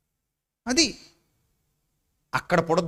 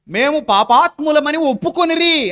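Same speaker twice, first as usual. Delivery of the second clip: emphatic scripture quote; 6 semitones higher, 6 dB louder than usual. Acted. Quoting scripture on confession.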